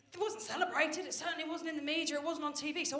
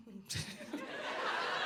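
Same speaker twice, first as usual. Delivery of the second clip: high laugh; 12 semitones higher, 4 dB quieter than usual. Real, not acted. Speaker laughing at own joke.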